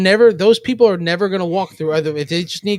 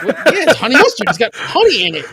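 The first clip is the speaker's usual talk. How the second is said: exasperated explanation; 8 semitones higher, 5 dB louder than usual. Retold or imitated. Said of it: "I'm like".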